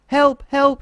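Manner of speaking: high, loud call for help; shouting for help. A narrator's reading. Dormouse calls for help over the sick donkey, loud high call. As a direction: call out across the snowy wood. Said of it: "shouted Hebe".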